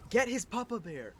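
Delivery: high, loud retold yell; imitating a mother's shouted order; mom yelling upstairs. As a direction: call urgently up the stairs as mom. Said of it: "my mom yelling upstairs at my dad".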